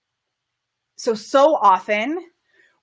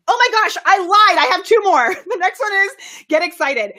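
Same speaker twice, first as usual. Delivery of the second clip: excited outburst; 8 semitones higher than usual. Real, not acted. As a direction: burst out with giddy excitement. Excited confession of more tips.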